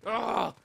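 short, loud scream of frustration; angry yell. Real, not acted. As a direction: let out a frustrated roar. Rage yell before venting.

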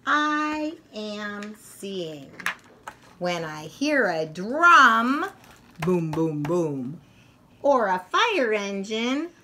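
lively picture-book read-aloud voice; reading to children. A narrator's reading. Reading the senses book aloud to young children, lively. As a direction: read it brightly to little kids.

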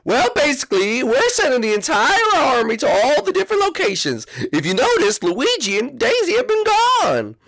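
put-on high, bratty Koopa kid voice; Iggy voice. Iggy briefing the visitor on his island plan, bratty and high. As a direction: talk like a bratty little Koopa prince.

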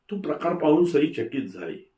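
ordinary delivery, nothing marked

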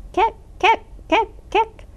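high squeaky kek calls; high frog chirps; tree frog croak. A narrator's reading. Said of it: "with their low".

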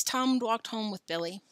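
plain reading voice

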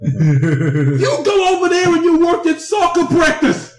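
high, slow shouting disappointed dad; Italian dad voice. Retold or imitated. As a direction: yell like a let-down Italian father. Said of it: "he sounds like an Italian dad disappointed at his kid".

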